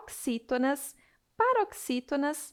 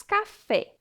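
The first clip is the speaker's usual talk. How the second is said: "Café" raised, stressed on FÉ; stress on final syllable; 8 semitones higher, 4 dB louder than usual. Shown as a demonstration. Teacher showing an example of the rule, "Café" stressed on FÉ. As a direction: hit the last syllable of "Café". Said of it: "é uma palavra oxítona".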